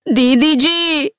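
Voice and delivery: high wailing cry of "தீதிஜி"; loud crying wail. A narrator's reading. Maid wailing to Girija in a crying voice. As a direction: wail the name out loud, half sobbing. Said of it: "பெரிதாக அழுகை குரல் கொடுக்கிறாள்".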